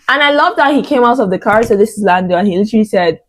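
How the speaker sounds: ordinary talk, nothing marked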